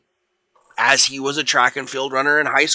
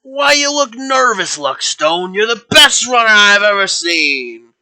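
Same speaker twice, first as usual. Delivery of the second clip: loud, high-pitched acted encouragement; rally your star runner, voice raised; coach's pep talk; 7 semitones higher, 5 dB louder than usual. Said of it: "And he goes".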